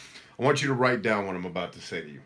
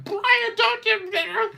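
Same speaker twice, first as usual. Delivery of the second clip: much higher, louder hammed-up mother's warning; mock scolding mom; 8 dB louder, much slower than usual. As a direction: squeal it like a mom forbidding a dark joke. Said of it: "my mom would be like".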